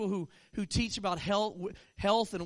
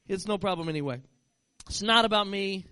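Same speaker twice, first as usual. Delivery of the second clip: normal talking voice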